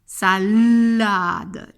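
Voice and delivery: "sa-LLLLade", loud and drawn out; emphasised L. Shown as a demonstration. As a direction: hold the L in the middle of "Salade". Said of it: "il y a le phonème L".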